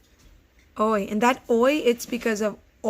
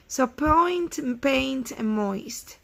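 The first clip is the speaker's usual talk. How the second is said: usual speaking voice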